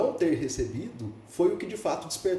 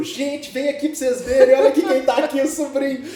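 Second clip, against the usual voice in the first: high, loud call to the whole room; excited calling out; 11 semitones higher, 10 dB louder than usual. Retold or imitated. Church lady calling the group to see the nephew, excited and loud. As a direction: announce the nephew to everyone, thrilled.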